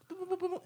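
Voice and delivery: light, high beeps; high boop noises. Retold or imitated. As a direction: chirp it like skipping clips. Imitates skipping through stories.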